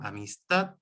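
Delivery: "amistad" with the last syllable marked; stress on final syllable. Shown as a demonstration. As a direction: lean on the "tad" of "amistad". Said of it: "marco el tono, el acento".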